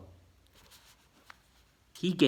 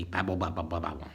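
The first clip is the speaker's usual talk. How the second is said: mock babble; 7 semitones lower, much faster than usual. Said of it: "things get kind of babbly".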